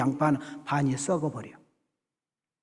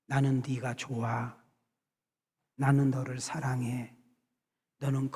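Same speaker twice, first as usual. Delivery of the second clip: soft, tender words to the onion; gentle loving words; 3 semitones lower, 6 dB quieter than usual. Retold or imitated. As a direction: coax it gently with love. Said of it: "이렇게 좋은 말, 듣기 좋은 말". (양파에게 다정하게 속삭이듯)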